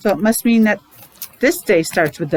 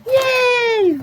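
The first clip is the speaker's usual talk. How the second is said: high joyful yay; cheering yay. Real, not acted. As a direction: cheer for spring. Cheering start of spring.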